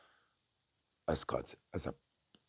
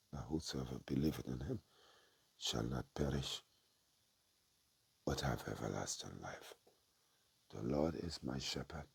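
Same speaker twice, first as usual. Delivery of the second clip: soft, low murmured prayer; prayer recitation; 4 semitones lower, 6 dB quieter than usual. Real, not acted. Alone before God, murmuring the Lord's Prayer in prayer. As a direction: pray quietly and reverently, line by line.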